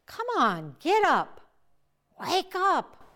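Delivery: urging to wake. Acted. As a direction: rouse a sleeping friend. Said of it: "And he said".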